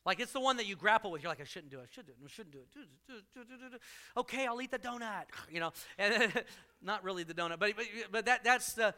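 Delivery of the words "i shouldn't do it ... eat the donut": inner dithering. Retold or imitated. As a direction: mutter the back-and-forth to yourself. Inner struggle acted.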